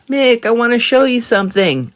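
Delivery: put-on high, sweet old lady voice. Old lady luring Mick, high and sweet. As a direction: coax him like a little old lady.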